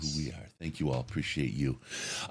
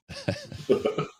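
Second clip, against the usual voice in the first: high-pitched laugh. Real, not acted. Laughing at his own voice.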